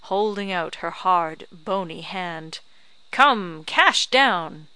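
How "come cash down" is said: loud, brisk demand for cash. A narrator's reading. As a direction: hold out the hand and demand it. Crone demands payment.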